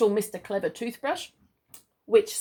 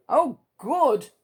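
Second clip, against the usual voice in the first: Mister Greedy, eager; 4 semitones higher, 5 dB louder than usual. Acted. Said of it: "said Mister Greedy".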